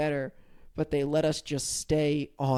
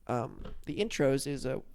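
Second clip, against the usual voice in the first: ordinary delivery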